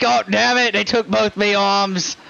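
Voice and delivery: put-on accent, cursing in dismay; exasperated injured shopkeeper. Shopkeeper finds his limbs missing, exasperated lament. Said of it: "looks down at his leg and goes".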